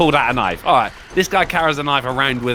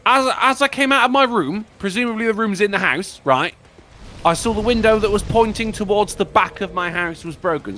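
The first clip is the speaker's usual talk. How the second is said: much higher, sarcastic reread stressing in; mocking reread; 7 semitones higher than usual. Real, not acted. Streamer mocking the story's confused setting, high and sarcastic. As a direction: reread it to mock the sloppy writing. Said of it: "are you in the house?".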